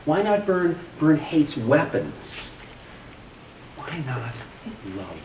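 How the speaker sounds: emphatic appeal in measured verse; poetry reading. Acted. Reading a fourteen-year-old's peace poem, earnest poetry reading. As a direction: build the repeated pleas, then soften for the last.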